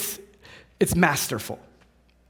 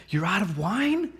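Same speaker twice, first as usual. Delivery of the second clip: softer, hushed shocked question; hushed surprise; 5 dB quieter than usual. Retold or imitated. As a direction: catch yourself and ask it quietly. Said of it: "no, shh".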